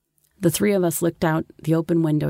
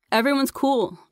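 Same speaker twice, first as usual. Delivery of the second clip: high-pitched call, restrained volume; mild narrated shout; shouting back; 8 semitones higher than usual. A narrator's reading. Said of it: "I shouted back".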